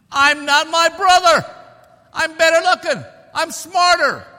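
loud, high-pitched protest; hammed-up indignant outburst; indignant retort. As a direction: shout back at the comparison, pitched high. Indignant answer to comparing relatives.

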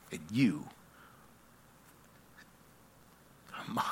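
low, soft, reverent delivery; tender, measured scripture proclamation; hushed sermon close. Acted. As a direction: let each phrase settle with long pauses.

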